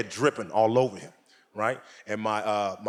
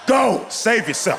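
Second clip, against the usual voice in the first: urgent shout; 8 semitones higher, slower than usual. Real, not acted. Warning the ladies.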